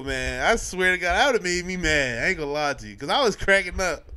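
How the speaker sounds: ordinary talk, nothing marked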